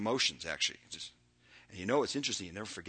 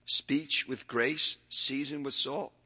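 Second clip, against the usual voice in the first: ordinary delivery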